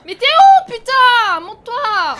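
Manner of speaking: panicked yelling; screaming in fear. Real, not acted. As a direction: scream it out in terror. Terrified gamer yelling at screen.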